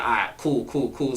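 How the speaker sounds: low, soft, offhand cool; too cool to care. Retold or imitated. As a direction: play it down like it's nothing. Playing it cool on invite.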